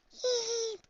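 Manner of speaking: tiny, high, soft squeal; startled yelp. A narrator's reading. Shy pony tackled into snow, startled yelp. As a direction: squeak out as if knocked over. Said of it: "she cries".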